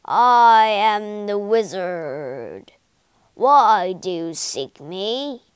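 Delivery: loud, slow, booming voice from nowhere; echoing wizard voice. Acted. Hidden wizard booms at the visitors, slow echoing boom. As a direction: boom it out like an unseen giant. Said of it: "a voice echoed".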